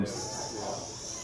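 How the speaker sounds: soft hissing s, like a snake; drawn-out s like a snake. Real, not acted. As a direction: let the s hiss out like a snake. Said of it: "Sorry for sounding like a snake there".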